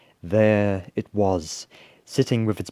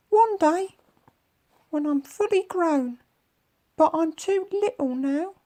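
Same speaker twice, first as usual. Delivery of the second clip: tiny, very high creature voice; high-pitched little monster; sad little creature; 3 dB louder than usual. A narrator's reading. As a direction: squeak it out as a small young monster. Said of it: "The strange creature just looked sad".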